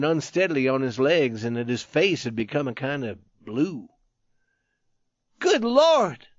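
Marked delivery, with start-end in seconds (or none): 5.4-6.3 high, loud startled cry; cried out. Acted. Youth seeing the tattered man turn blue, a high loud cry. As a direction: shout it in shock. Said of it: "the youth cried".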